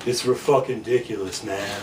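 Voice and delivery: low, quiet slacker mumble; punk kid's voice. Acted. Punk at a coffee shop table, low apathetic voice. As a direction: drop low and trail off on "man". Acts out the punk kid speaking.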